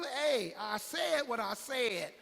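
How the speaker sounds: loud, slow menacing challenge; swearing defiance. Acted. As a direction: dare them to ask again. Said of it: "the Bible say he got to swearing".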